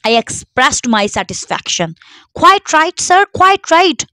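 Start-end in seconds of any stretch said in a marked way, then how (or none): 2.3-4.0 high, eager tailor fawning over a rich client; an obsequious tailor, pitched high and loud; fawning shopkeeper. Acted. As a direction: gush with loud, high flattery. Fawning tailor greets millionaire.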